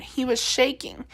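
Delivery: plain reading voice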